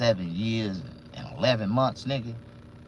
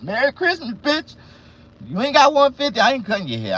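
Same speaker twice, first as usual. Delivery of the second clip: high, loud jeering yell at broke customers; Merry Christmas, bitch, shouted high and loud; mock shout; 12 semitones higher, 7 dB louder than usual. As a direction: bark it out like a taunt. Yelling price at customers.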